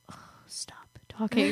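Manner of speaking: slow, whispered plea, acted out; irritated aside. Reacting to accents that irritate her, exasperated slow whisper. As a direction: whisper it slowly, fed up. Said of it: "Those accents sometimes really irritate me".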